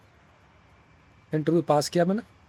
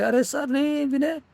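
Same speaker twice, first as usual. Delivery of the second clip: pleading boss; 8 semitones higher, slower than usual. Retold or imitated. Boss pleads with quitting employee.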